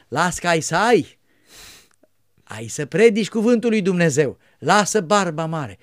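reassuring. Retold or imitated. Monk reassuring the boy.

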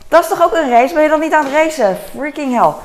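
high, loud incredulous outburst; disbelieving and worked up; exasperated. Retold or imitated. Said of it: "Ik van".

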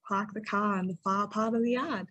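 Boston speech with the R's dropped; Boston accent demo. Shown as a demonstration. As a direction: drop every R like a Bostonian.

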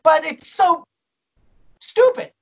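whiny, fed-up mock protest; exasperated complaint. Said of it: "I'm like".

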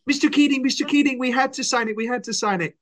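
very high, frantic mock plea; high pleading student. Retelling the film ending, a high pleading student voice. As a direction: squeak it out like a desperate pupil. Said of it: "It was like".

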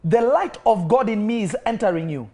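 from intense to high, loud commanding release; building to full preaching. Acted. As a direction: drive it up like an altar call climax. Building deliverance proclamation.

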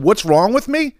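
retold indignant comeback. Retold road rage argument.

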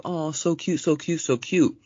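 high, slow cooing gush; mock girly gushing. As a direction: coo it like a smitten girlfriend. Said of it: "She was like".

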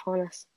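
Honus said as HAH-nus; first-syllable AH. Shown as a demonstration. Correcting co-host on the wrestler's name, Honus as HAH-nus. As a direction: open "ah" at the start of Honus. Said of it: "that it was pronounced".

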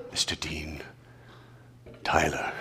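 hushed, reverent whisper; pious whisper. Pretend. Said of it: "in a very pious way".

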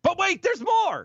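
high, loud pitchman hype; infomercial. Acted. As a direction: sell it like a TV ad.

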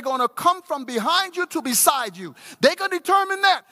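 high, loud, building to a climax; preaching at full force. Acted. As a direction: drive it home line by line.

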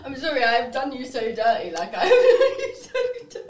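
apologizing through hysterical laughter; loud, breaking into laughs; laughing hard. Real, not acted.

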